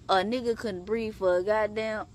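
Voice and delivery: normal talking voice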